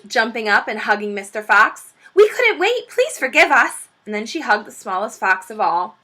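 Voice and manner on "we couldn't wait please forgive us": high, louder breathless relief; excited Missus Fox. Acted. Missus Fox welcoming her husband home, overjoyed. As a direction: gush it out like a relieved wife.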